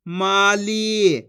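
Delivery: माली very slow, both vowels held; syllables drawn out. Shown as a demonstration. Teacher answers for learners, माली drawn out. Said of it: "जिस तरीके से मैं खींच खींच के पढ़ रहा हूँ".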